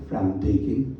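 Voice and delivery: ordinary delivery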